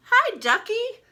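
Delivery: put-on high, loud boy's greeting; bright kid voice greeting a new friend; boy greets the toy duck, high and loud; excited boy.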